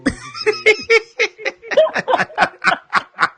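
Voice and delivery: shrieking laughter; high-pitched laughing fit. Real, not acted. Laughing hard at the joke.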